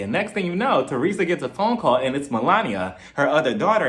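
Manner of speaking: ordinary talk, nothing marked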